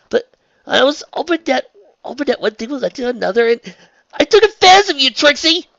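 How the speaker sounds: high, loud tearful self-reproach; distraught confession. A narrator's reading. Raindrops confesses her hopes to Trixie, distraught. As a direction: wail the guilt out haltingly.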